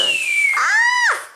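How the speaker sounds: sudden high shriek of surprise; startled shriek. Real, not acted. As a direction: shriek in shock. Startled scream seeing herself.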